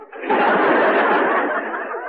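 unvoiced wheezing laugh; a silly airy chuckle; breathy comic laugh.

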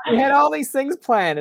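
high, loud exaggerated protest; mock outrage. Host playfully scolding guest over plans, loud mock indignation.

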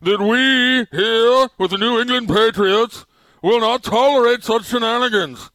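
high, loud, slow pompous tycoon; Bob Kraft snooty rich guy voice. Acted. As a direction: puff up like a snooty rich owner. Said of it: "that's my snooty rich guy voice".

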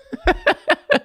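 high-pitched burst of laughter. Real, not acted. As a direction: squeal with laughter. Laughing at the joke.

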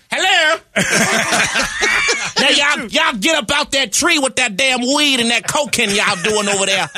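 hammed-up Madea shouting at kids in the tree. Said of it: "I created Madea".

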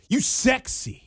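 high, put-on catcall voice; mock catcall. Retelling a stranger's catcall, high put-on voice. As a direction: toss it out like a street flirt. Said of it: "but she was like".

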